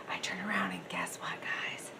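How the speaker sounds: whispered, teasing the reveal; a conspiratorial whisper to viewers. Real, not acted. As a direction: lean in and whisper the secret. Secretive aside to viewers.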